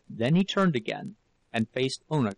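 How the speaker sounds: ordinary delivery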